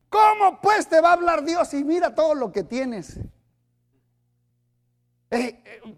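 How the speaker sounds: normal talking voice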